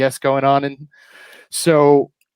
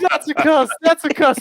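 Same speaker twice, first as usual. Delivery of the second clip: very high mock outrage; scandalised instructor; 14 semitones higher, 3 dB louder than usual. Laser tag girl catching a swearer, high hammed-up indignation. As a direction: squeal the accusation like shocked staff. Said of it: "She's like".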